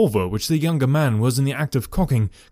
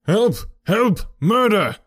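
high, urgent scream for help; acted scream, raised in pitch; screamed cry for help; 7 semitones higher, 3 dB louder, slower than usual. A narrator's reading. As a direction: cry out as if being attacked. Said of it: "a sudden scream of".

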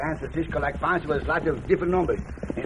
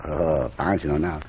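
a much lower put-on voice; 13 semitones lower, 4 dB quieter, slower than usual. Pancho answers Cisco's request for a low voice, lower. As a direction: drop the voice down low for another man. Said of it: "a low voice".

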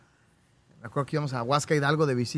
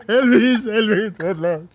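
llanto imitado; 4 semitones higher, 6 dB louder, much slower than usual. Retold or imitated. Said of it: "Sí, y lloró".

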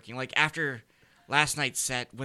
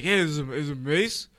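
puffed-up tough guy, a bit louder; mock Lachlan as a macho braggart; mocking impression; 3 semitones higher, 4 dB louder than usual. Friend mocks Lachlan's voice as a tough guy. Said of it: "that's how you talk".

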